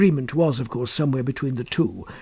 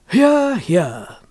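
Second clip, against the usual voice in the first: dry mock applause, a touch higher; ironic; 6 semitones higher, 4 dB louder than usual. A narrator's reading. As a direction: say it with ironic praise. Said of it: "applauding ironically".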